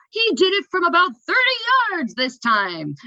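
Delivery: high, loud, disbelieving commentator call; incredulous announcer. Retold or imitated. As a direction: imitate an amazed match announcer. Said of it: "like, incredulously".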